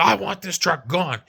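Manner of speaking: retold angry demand. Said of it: "this guy comes screaming up".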